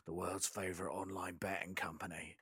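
low, hushed Cockney ad-read; Cockney gangster accent. Retold or imitated. Quoting a review mocking Ray Winston's Russian accent, low Cockney imitation. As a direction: lightly imitate a Cockney voiceover, dropped soft and low. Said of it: "You can hear his Cockney".

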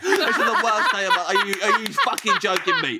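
high, laughing outrage; laughing mock rage. Real, not acted. Host ranting at rival baker, high laughing mock outrage. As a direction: rant through laughter in mock disbelief.

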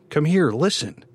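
high, loud, urgent appeal; pressing her to listen; urgent insistence. A narrator's reading. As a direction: pull her over with your voice.

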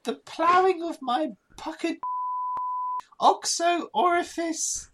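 put-on high-pitched German accent; stagey German accent reading smut. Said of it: "Do it in a German voice".

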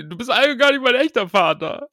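put-on teen outburst. Shown as a demonstration. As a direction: shout it like a rebelling teen. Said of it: "Er muss noch sowas rufen wie".

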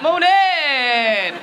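hearty upper-class hello, no shame at all; loud posh breezy greeting. Retold or imitated. Posh ladies greeting Michelle in the kitchen, breezy and loud. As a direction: sing out a shameless posh morning. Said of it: "They're just like that".

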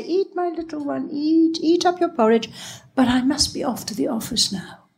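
put-on high-pitched mother urging a child to eat; coaxing mother. A narrator's reading.

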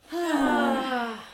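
big open breathy "ah"; loud acted sigh. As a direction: let out a loud, high sigh. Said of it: "And so you're like".

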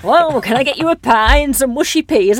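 mock a friend laying on Mancunian thick; exaggerated Greater Manchester accent. Imitating drama-school mates back home, hammed-up Manchester accent. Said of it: "they have a completely different accent".

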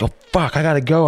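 high, panicked oh fuck I gotta go; panicked yelp. Retold or imitated. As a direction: jump up in alarm, voice shooting up. Panicked realizing he's late.

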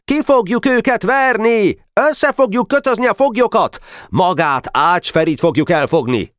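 put-on high, eager boys' cheering; triumphant young war boasts; boasting battle cries. A narrator's reading.

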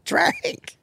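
very high squeal of a single word; squealed drink. Pretend. Calling the table to drink in a high squeal. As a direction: squeak it out like a cartoon.

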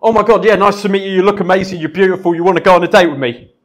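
put-on nervous guy firing compliments at a girl, rushed and loud; nervous rushed blurt. Shown as a demonstration. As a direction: blurt it all out at once, far too eager. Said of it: "So that might go something a little bit like this".

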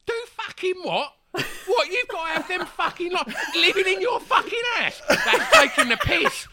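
loud, high, outraged swearing with laughter breaking through; outraged coworker. Retold or imitated. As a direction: blast it out like a furious coworker defending him. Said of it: "this girl in the office talking to him".

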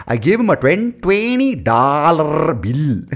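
high, loud Indian putting on an American twang; mock American-tinged accent. Mocking Indians abroad, loud put-on American accent, stretched. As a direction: drag out "twenty dollar bill" in a fake accent. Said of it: "they say this thing, they're like".

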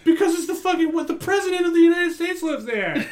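high, loud, laughing disbelief; exasperated. Real, not acted. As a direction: blurt it out in amazed exasperation.

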